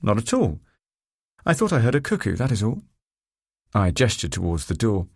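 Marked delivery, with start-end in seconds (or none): 0.0-2.8 mock-innocent denial, pitched up; higher-voiced pretence of denying it; feigned repudiation. A narrator's reading. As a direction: protest lightly, not quite convincingly. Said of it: "I feigned repudiation".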